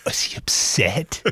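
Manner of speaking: slow, hushed whisper of Is he upset?; whispered worry. Retold or imitated. Holly asking Kit about Cato, whispered. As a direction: lean in and whisper it anxiously.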